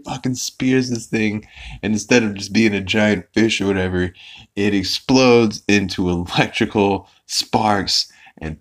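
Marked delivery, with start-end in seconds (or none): none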